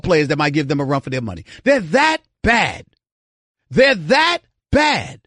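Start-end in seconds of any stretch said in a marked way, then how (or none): 1.6-2.8 drawn-out, slow, emphatic THAT and BAD; exasperated emphasis. Real, not acted. Ticked-off host hammering his point. 3.7-5.2 very slow, higher-pitched, heavy THAT, then BAD; exasperated emphasis. Real, not acted. Repeats point for emphasis, ticked off.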